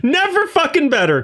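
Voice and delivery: squeaky mock-cheery boast; high joking voice. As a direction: pitch it way up, chipper. Continues joking high answer.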